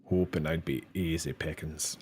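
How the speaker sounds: quiet imitation of a Scottish accent; mock Scottish accent. Player testing a game character's line, mock Scottish accent. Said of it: "a Scottish accent".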